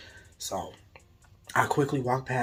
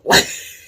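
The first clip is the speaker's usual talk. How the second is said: loud burst of laughter; real laugh breaking into the story; short laugh; 8 dB louder than usual. Real, not acted. Storyteller amused by the scary doll, laughing out loud.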